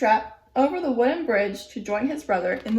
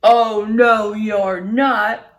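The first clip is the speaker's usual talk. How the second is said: loud troll roar; troll blocking the middle goat, shouting; 8 dB louder, much slower than usual. Acted. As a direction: bellow it like a furious troll. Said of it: "shouted the troll".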